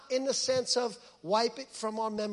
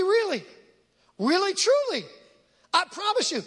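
much higher, louder "really, really, truly"; emphatic; 8 semitones higher, 6 dB louder than usual. Real, not acted. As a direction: punch every "really" as hard as you can. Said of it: "I wanna emphasize this word".

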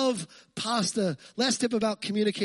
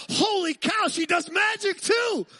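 mock amazement; 10 semitones higher, 3 dB louder, slower than usual. Husband amazed at wife finding salt.

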